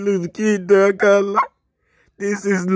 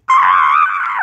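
startled scream; 13 semitones lower, 4 dB louder than usual. Real, not acted. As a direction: scream of mock horror. Recoils from a creepy face.

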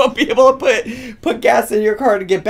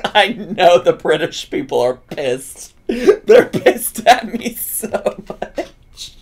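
ordinary delivery

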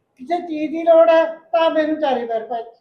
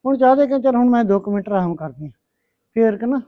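loud, lower, worn-out grumbling; loud tired complaining; 4 semitones lower, 6 dB louder than usual. Acted. Mother complaining to her daughter about chores, loud weary grumble.

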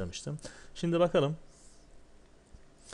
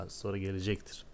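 usual speaking voice

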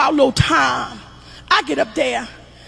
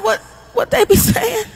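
frightened, pitched way up; scared high question; 7 semitones higher than usual. Retold or imitated. Mother frightened by her son's voices, high-pitched. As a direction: ask it with rising panic.

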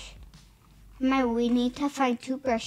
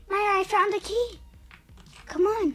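much higher and louder, thrilled; excited discovery; 9 semitones higher, 3 dB louder than usual. Real, not acted. Girl calling her sister after finding the key, excited. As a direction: shout the good news to your partner. Finds key in horror game.

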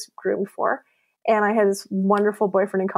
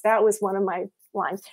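normal talking voice

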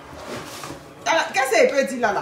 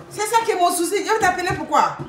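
indignation moqueuse; 6 semitones higher, faster than usual. Retold or imitated. Répond à la femme de Laval.

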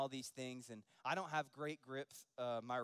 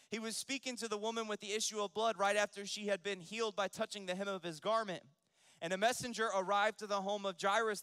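raised, loud Scripture proclamation; full-force preaching; 7 semitones higher, 7 dB louder than usual. Acted. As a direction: preach it high and loud to the congregation. Preaches the Bible story.

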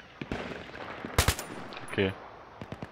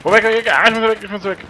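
panicked; 9 semitones higher, 4 dB louder, faster than usual. Real, not acted. Fleeing in game.

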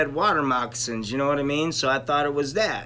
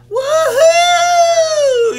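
high, loud whooping yell; mock triumphant woohoo shout; excited yell; 11 dB louder than usual. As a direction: whoop it out with joy. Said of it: "I scream out".

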